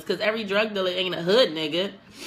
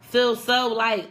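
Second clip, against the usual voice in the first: ordinary delivery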